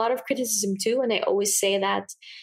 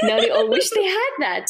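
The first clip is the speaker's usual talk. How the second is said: laughing, pitched much higher; 11 semitones higher, 4 dB louder than usual. Real, not acted. Joking with guest about eyebrows, laughing. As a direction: laugh through the line.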